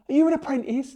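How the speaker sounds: a rough take on Bob the judge; Bob impression. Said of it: "crappy impression of Bob".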